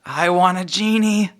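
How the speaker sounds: put-on high, wanting little boy; a child pleading for a genie.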